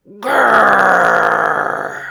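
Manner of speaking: long loud growl. Acted. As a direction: growl to scare the rabbits. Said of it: "took a deep breath and growled".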